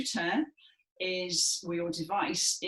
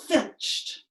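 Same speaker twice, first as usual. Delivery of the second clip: filched snapped out higher and louder; punchy single word; 7 semitones higher, 4 dB louder than usual. Shown as a demonstration. Teacher leading the class in Aegeus's one-word gesture, punchy. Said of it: "with a grabbing gesture".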